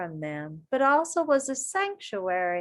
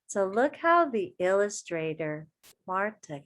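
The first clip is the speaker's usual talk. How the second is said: plain reading voice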